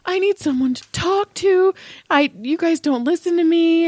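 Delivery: high-pitched imitation of a crying teen; mock crying. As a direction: imitate an upset son pleading. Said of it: "he's crying and he's like".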